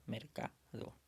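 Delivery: "mercado" split into mer, ca, do with short gaps; syllable by syllable. Shown as a demonstration. Teacher recapping the middle syllable, "mercado" syllable by syllable. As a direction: separate the three syllables of "mercado", softly.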